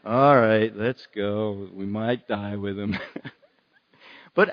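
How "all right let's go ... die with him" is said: Eeyore impression. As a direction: say it like a gloomy, resigned Eeyore. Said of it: "it sounded like Eeyore at that point".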